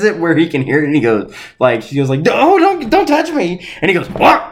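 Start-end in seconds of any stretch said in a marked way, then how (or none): 2.3-3.6 high-pitched imitation of a recoiling bandmate; mock disgusted protest. Lacey fending off the sweaty singer, mock disgusted protest. 4.1-4.4 mock indignant what. Acting out singer's reaction.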